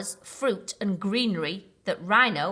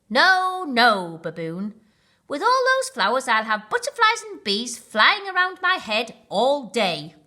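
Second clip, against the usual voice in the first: protesting; 5 semitones higher, 7 dB louder than usual. Acted. Rhino protesting flowers.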